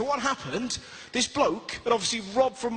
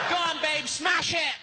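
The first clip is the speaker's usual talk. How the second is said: mock cheer; 7 semitones higher than usual. Cheering himself on.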